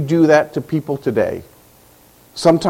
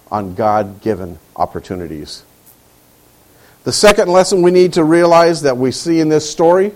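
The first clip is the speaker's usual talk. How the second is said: normal talking voice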